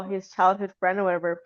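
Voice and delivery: ordinary talk, nothing marked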